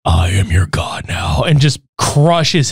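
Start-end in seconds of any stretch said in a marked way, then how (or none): 0.0-1.4 low, slow menacing voice; slow and low, a monster's decree; deep god voice. Retold or imitated. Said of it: "I love the fucking voice alteration".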